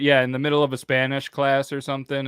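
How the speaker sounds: ordinary delivery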